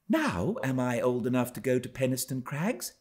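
put-on pleading young Cathy. A narrator's reading. Cathy keeps asking her father, high eager voice. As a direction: beg like an impatient little girl. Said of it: "the constant question in her mouth".

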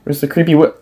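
usual speaking voice

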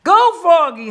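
high rallying cheer; hollered cheer. A narrator's reading. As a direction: holler it out to the player. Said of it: "hollered Frogalina".

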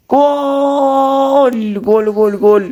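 excited goal shout; turkish football commentator. Real, not acted. Celebrating goal in game. (heyecanlı gol bağırışı)

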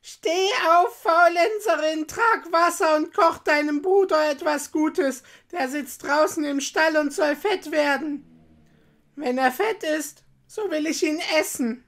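high, loud witch's scolding shout; witch calling out. A narrator's reading. Witch shaking Gretel awake, shouting orders. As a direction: order her about loudly in a high witch voice. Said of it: "rüttelte sie wach und rief".

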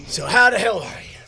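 ordinary talk, nothing marked

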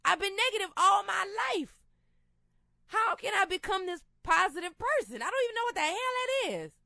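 pleading, at a loss; exasperated self-doubt. Retold or imitated. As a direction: throw up your hands, voice climbing. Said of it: "I'm like".

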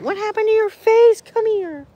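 high, loud worried coo. Real, not acted. Owner finding a bump on her horse's face, high worried baby talk.

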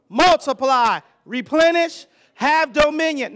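loud, driving pulpit delivery; fired-up sermon call and response; full-force preaching. Acted. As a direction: preach it out loud to the whole room.

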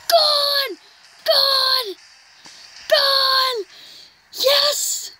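high, jubilant shouts of gone; home run call. Real, not acted. Gamer watching his home run leave the park, jubilant high shouting. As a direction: call the homer like a thrilled announcer.

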